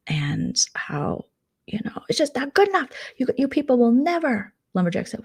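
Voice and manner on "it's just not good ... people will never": mock complaint. Acting out Lumberjack's complaint.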